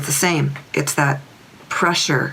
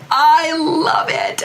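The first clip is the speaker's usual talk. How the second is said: delighted; 12 semitones higher, much slower than usual. Retold or imitated. Horse voiced, shaking her head.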